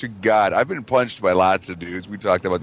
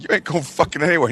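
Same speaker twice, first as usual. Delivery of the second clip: higher-pitched mock angry order; retold boss's refusal; 6 semitones higher than usual. Boss to injured worker wanting home, mock irate. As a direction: act out the boss laying down the law.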